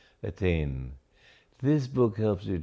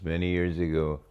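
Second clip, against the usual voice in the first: ordinary talk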